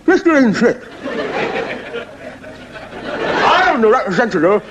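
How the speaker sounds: high, wide-mouthed frog voice. Acted. Frog spokesman pleading to Ian Smith, high and wide-mouthed. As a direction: stretch the mouth wide and squeak it out. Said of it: "He said".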